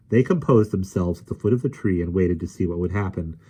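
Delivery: usual speaking voice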